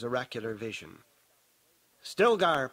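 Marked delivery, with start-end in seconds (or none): 2.0-2.7 loud, raised hail; higher-pitched call across a distance; calling shout. A narrator's reading. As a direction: shout a name to summon someone. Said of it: "Paul shouted".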